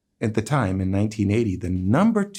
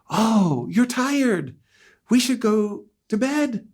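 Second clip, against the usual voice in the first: Victor's bedtime line; 12 semitones higher, slower than usual. Retold or imitated.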